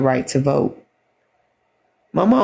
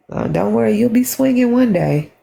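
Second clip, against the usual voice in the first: higher, mocking repeat of the threat; 7 semitones higher than usual. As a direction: throw their words back, pitched up in mockery. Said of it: "And I was just like".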